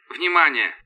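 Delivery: thin radio-filtered announcement; voice in headphones. A narrator's reading. Werner alerting the crew over headphones, filtered radio voice. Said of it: "сказал в наушниках Вернер".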